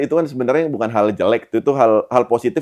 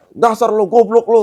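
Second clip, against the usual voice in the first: mock scolding; 10 semitones higher than usual. Host acts out someone berating guest.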